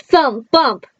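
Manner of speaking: ordinary delivery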